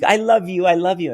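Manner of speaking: high, loud, hurried plea; fawning child. Retold or imitated. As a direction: placate the monster like a frightened little boy. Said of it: "he's fawning".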